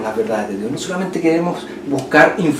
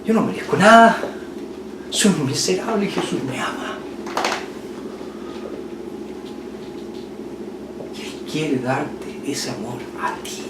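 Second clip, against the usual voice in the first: almost crying; 3 semitones higher, 6 dB quieter than usual. Shown as a demonstration. Father telling his son of Jesus' love, nearly in tears. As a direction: plead it as if about to cry. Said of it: "decirle prácticamente llorando".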